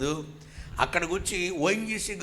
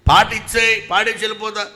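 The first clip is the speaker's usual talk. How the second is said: loud demand; 8 semitones higher, 12 dB louder than usual. Retold or imitated. Demanding the song.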